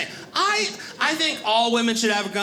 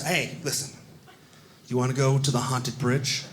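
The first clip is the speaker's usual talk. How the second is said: low tour guide; 9 semitones lower than usual. Retold or imitated. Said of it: "And he goes".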